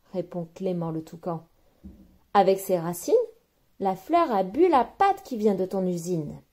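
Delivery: plain reading voice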